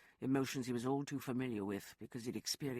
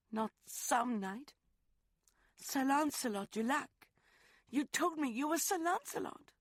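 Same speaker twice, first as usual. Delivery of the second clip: put-on high, louder young woman's voice, accusing; Rosalind, hurt; 13 semitones higher, 6 dB louder than usual. Woman confronts lover over his false identity, high and sharp. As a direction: throw his lie back at him.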